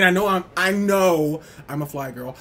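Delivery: ordinary talk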